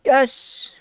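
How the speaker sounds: high gasp and yes; thrilled the pick won; excited gasp. Real, not acted. Streamer thrilled the dog pick won, high gasped yes.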